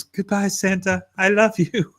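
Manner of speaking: high, sweet child impression; little girl. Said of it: "the little girl looks at him".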